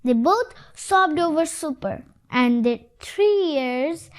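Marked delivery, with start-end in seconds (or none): none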